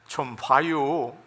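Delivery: slow, unhurried Chungcheong line; Chungcheong dialect. Shown as a demonstration. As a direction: drawl it out Chungcheong style. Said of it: "충청도는 짧대요".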